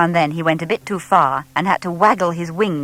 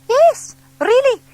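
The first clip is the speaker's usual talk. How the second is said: high, slow, emphatic; lady's lifted, earnest voice; eager insistence; 6 dB louder, slower than usual. A narrator's reading. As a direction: convince the doubting owl. Lady insists on bats.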